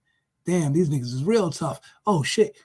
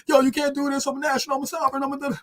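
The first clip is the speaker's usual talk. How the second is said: much higher pleading protest; desperate protest; 8 semitones higher, much faster than usual. Retold or imitated. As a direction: protest while being hauled off. Sovereign citizen being grabbed.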